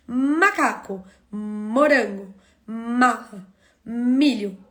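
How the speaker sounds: "Macaco, morango, mala, milho", each opening on a long hummed mmm; stretched initial M. Shown as a demonstration. Teacher picking figures by starting sound, stretched initial M. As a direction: hold the first M of "Macaco, morango, mala, milho" before the rest of each word. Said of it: "iniciam com o sonzinho".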